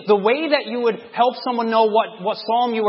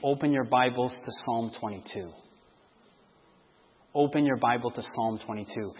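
hushed aside; 9 semitones lower, 9 dB quieter than usual. Acted. Quiet aside as if Jesus.

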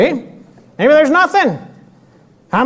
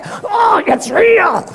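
stubborn patient; 9 semitones higher, much slower than usual. Retold or imitated. Patient insists loudly.

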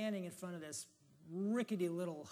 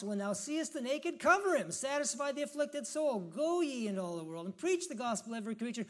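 loud, high, ringing scripture commands; full-force preaching; 4 semitones higher, 9 dB louder than usual. Acted. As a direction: thunder the Lord's commands at the congregation.

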